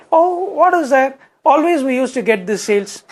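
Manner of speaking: high, sneering boss voice, pitched well up; harsh boss. Retold or imitated. Komudi brushing off Anand's sales report, high and sneering. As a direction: belittle him like a scornful manager. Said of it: "she'd say".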